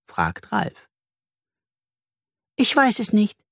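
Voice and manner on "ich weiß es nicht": high little bee voice; small high character voice; bee confessing. A narrator's reading. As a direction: admit it in a high-pitched voice. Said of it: "gesteht Bertha nach einer Weile".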